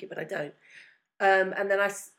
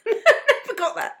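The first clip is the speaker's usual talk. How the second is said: high, slow laughing denial; amused disbelief, laughing; 13 semitones higher, 9 dB louder, slower than usual. Real, not acted. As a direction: laugh as you say it.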